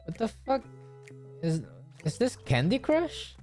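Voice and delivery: usual speaking voice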